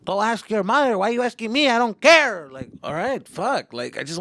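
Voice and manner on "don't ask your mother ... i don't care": loud, high-pitched irritated outburst; irritated retort. Retold or imitated. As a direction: fire it back louder and higher, fed up. Retold irritated relative snapping at kid.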